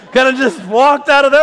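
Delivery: real joyful laughter while telling it; laughing. Real, not acted. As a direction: laugh through the words in real delight. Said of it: "I was so excited".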